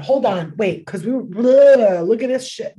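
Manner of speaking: ordinary delivery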